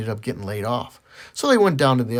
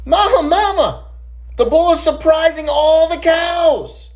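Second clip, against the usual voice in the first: put-on high, loud, slow child's yell; excited kid shout; 7 dB louder, slower than usual. Boy bursts into the house yelling to Mama. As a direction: holler it across the house like a thrilled kid. Boy runs in calling mother.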